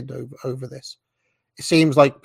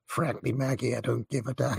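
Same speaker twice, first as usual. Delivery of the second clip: mock Ronald Reagan voice; 7 dB quieter than usual. Reagan flirting with Maggie on the phone, a hushed mock voice. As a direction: play Reagan teasing Maggie in a soft put-on voice. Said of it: "Can you do that accent again?".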